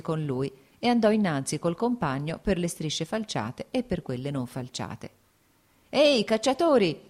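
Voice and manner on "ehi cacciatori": high, loud hail to hunters; raised, hailing voice; peasant's shout. A narrator's reading. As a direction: call out to men across a field. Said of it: "gridò loro uno dei contadini".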